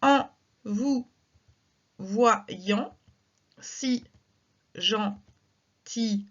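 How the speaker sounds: syllables spaced with pauses, "gentil" given a sounded final e; slow scansion. Shown as a demonstration. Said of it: "je dois insister sur le ye".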